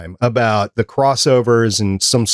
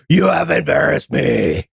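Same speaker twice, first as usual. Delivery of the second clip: Kingpin yell; 3 semitones higher than usual. Pretend. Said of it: "If you had just yelled".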